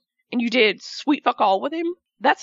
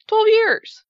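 exasperated outburst; 9 semitones higher, 7 dB louder than usual. Real, not acted. Venting about a wrestler's release.

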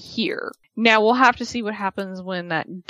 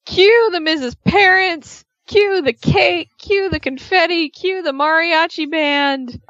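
higher, slow, grand announcer build-up; mock fanfare; 8 semitones higher, slower than usual. Mocking the couple's expected pregnancy party, hammed-up. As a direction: announce it like a big party reveal.

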